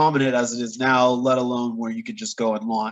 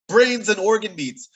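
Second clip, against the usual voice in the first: shocked disbelief; 7 semitones higher than usual. Real, not acted. Shocked repeat of recipe.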